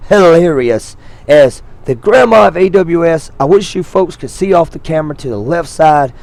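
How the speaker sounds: normal talking voice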